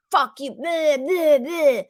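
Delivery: sneering mock rant at a partner; mock nasty yelling. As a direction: spit it out like a nasty triggered partner. Mocking a screaming triggered partner.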